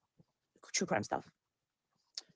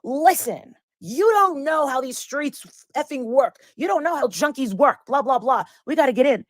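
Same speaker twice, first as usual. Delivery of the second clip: a bit louder and higher, like a heated friend lecturing her; imitating a friend flipping out, moderately raised; retold flipping out; 6 semitones higher, 5 dB louder than usual. Friend telling the narrator how the streets work, imitated heated rant. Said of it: "she flipped out on me".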